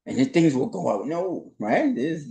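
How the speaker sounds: usual speaking voice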